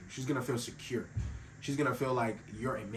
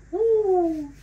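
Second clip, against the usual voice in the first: loud, high "woo"; sudden whoop of emphasis; excited whoop; 11 dB louder than usual. Real, not acted. Exclaims after own statement.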